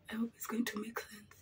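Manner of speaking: hushed whisper. Real, not acted. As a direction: breathe it under your breath. Whispered hope while doing makeup.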